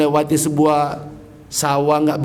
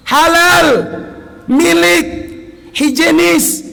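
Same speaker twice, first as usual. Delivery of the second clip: each word shouted alone, much higher and louder; emphatic list; 13 semitones higher, 5 dB louder, slower than usual. Real, not acted. Preacher drives home the point about fasting, loud separate shouts. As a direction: hammer every word home from the pulpit.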